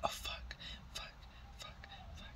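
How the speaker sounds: retold frightened whispered curses; panicked whisper. Recalling spotting her ex's lookalike on Bart, hushed panic. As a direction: breathe the curses out, scared and hushed.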